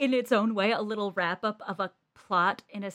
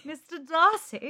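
slow, higher British "Mister Darcy", falling on "Darcy"; British accent; 7 semitones higher, slower than usual. Retold or imitated. Cohost echoing the swooning British "Mister Darcy". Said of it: "you just went".

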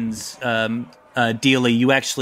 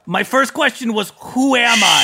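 exasperated, high-pitched turtle; baby turtle character; 5 semitones higher than usual. Acted. Confused turtle king protests.

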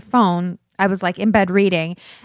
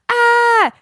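high, loud delighted squeal; excited squeal; 14 semitones higher, 5 dB louder than usual. Acted. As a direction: squeal out a fan's written excitement. Reads out a listener's excited comment.

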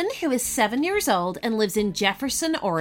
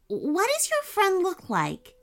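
put-on young girl voice; 8 semitones higher than usual. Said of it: "she said".

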